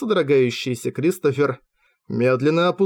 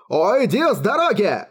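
snarl; 7 semitones higher than usual. A narrator's reading. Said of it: "прорычал он".

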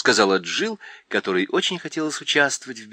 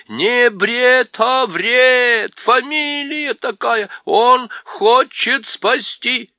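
much higher, loud correcting cry; owl shouting; 12 semitones higher, 8 dB louder, much slower than usual. A narrator's reading. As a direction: hoot the correction loudly.

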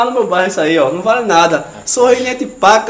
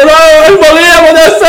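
higher, louder panicked cry; desperate shout; 7 semitones higher, 7 dB louder than usual. Retold or imitated. Man on the bus crying out in panic, loud and high. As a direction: yell it like the mucus is about to fall. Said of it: "ele gritou".

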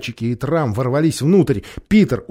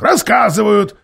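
agreeing; 9 semitones higher, 7 dB louder than usual. A narrator's reading. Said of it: "согласился Никобрик".

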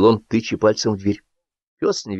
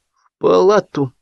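usual speaking voice

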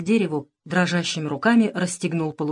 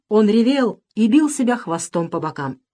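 usual speaking voice

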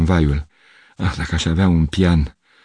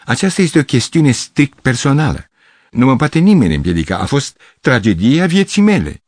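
put-on higher, earnest old artist insisting; solemn protest; 7 semitones higher than usual. A narrator's reading. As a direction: insist that it is a private matter. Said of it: "interveni Gavrilescu".

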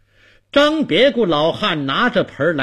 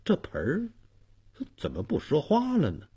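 softer, halting wonder; puzzled; 2 semitones higher, 6 dB quieter, faster than usual. Acted. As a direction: ask it half to yourself, mystified. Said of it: "老汉也非常纳闷儿". (纳闷儿地轻声自问)

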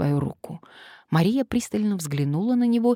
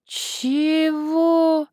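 baffled; 12 semitones higher, 4 dB louder than usual. Acted. Man baffled again.

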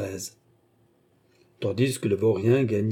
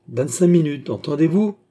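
pressing her louder and higher; cried out; 5 semitones higher, 4 dB louder than usual. A narrator's reading. A woman cries out the deadline to the rascal, loud. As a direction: snaps the deadline at him. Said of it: "cria-t-elle".

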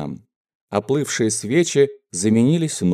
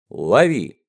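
usual speaking voice

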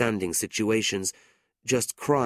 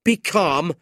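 louder, bitter repeat; angry echo; 8 semitones higher, 8 dB louder than usual. A narrator's reading. Nicholas angrily echoes his sister's plea. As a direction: throw the words back in anger. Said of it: "his face red with anger".

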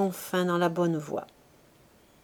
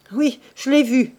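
higher, louder mocking agreement; sneering; 4 semitones higher, 6 dB louder than usual. A narrator's reading. Son mocking his father to his mother, sneering. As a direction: agree with a scoff. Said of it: "reprit-il en ricanant".

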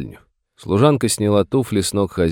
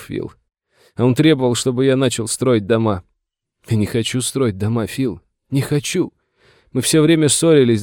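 higher-pitched, aggrieved complaint; tearful lament; 6 semitones higher than usual. A narrator's reading. Father complains about his son to the sheriff, tearful. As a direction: pour out a wounded father's grievance. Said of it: "всхлипывая и шмыгая носом".

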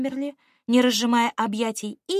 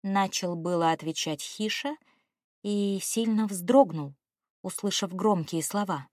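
ordinary delivery, nothing marked

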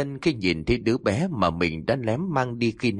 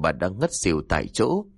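ordinary delivery

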